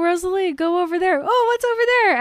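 high, hammed-up fake curiosity; mock distraction ploy. Acting out an imagined diversion trick.

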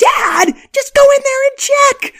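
loud high yell at dad; shouting. Acted. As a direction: yell it out in frustration.

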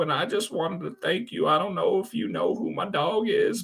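put-on high, wavering old fella voice; old man voice. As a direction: play a grateful old man. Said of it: "That's just my old man voice".

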